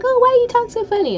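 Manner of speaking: high mocking voice of a mean person. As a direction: squeak it out like a taunting bully. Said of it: "some people are mean and they're like".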